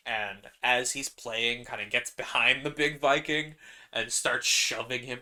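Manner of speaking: ordinary talk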